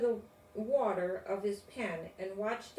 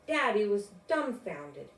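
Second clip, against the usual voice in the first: ordinary delivery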